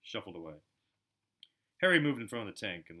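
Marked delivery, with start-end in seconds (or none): none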